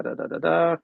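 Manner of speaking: ordinary talk, nothing marked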